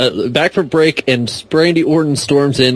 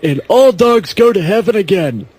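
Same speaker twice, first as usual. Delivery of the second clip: higher, hammed-up hype pitch; mock promo voice; 5 semitones higher than usual. Friend pitching sequels as fake director, hammed-up promo voice. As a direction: sell it like a cheesy movie exec.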